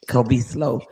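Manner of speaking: grumbled inward doubt, voice dropped low; dubious reaction. Retold or imitated. Rider sizing up a woman on a bike, dubious. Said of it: "I was like".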